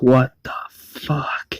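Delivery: slow, voiceless, stunned whisper; whispered curse. Retold or imitated. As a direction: drag out the curse in a hush. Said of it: "Avesta's like".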